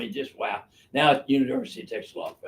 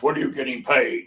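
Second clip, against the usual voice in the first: usual speaking voice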